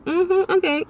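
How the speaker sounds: high knowing mm-hmm okay; a sly, impressed mm-hmm. Retold or imitated. Telling how she reacted to the hot guy, high and sly. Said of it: "So I was like".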